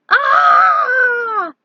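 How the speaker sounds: very high loud cry of pain; pained scream. Acted. Knight burned by slime, screaming. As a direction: scream as the acid burns.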